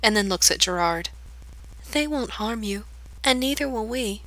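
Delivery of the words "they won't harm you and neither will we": put-on much softer, quiet sad girl; solemn girl soothing the stranger, very soft. A narrator's reading. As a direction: reassure him quietly. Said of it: "this one's voice is low".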